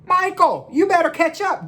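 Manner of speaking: put-on high, lively kid's call; teasing friend. David calling to Michael, who is behind in the game, with a high teasing call. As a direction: call over to him like a boy on the court. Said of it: "David said".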